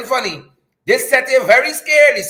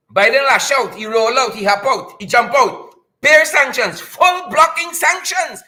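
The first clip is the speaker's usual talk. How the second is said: ordinary talk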